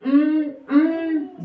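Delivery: two hummed beats, high and pressed; humming syllables. Shown as a demonstration. Teacher humming cotton for the class, two closed-mouth beats. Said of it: "दो बार हमने बोला".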